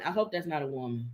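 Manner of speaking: low, quiet worried murmur; hushed mock worry. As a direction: murmur it low, hoping it isn't true. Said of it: "Man, woman".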